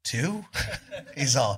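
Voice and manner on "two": high, small tentative question; incredulous guess. Retold or imitated. Singer makes his last guess at friend's sales, incredulous. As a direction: squeak up in disbelief.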